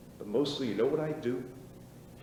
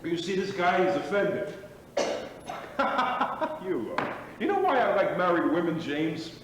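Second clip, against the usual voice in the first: mocking laugh; 6 semitones higher, 7 dB louder than usual. Mocking friend, laughing loudly.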